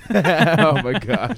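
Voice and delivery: said through a laugh, slow. Real, not acted. Cohost cracking up at the Vikings joke, laughing through it. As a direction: laugh out the words.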